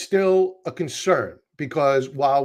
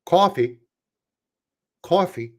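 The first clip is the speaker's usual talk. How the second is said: attempted Midwestern "Coffee"; careful Midwestern accent on "Coffee"; Midwesterner accent. Shown as a demonstration. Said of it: "Let me try to do it as a, uh, Midwesterner".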